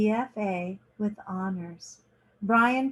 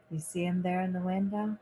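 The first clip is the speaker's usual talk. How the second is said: ordinary delivery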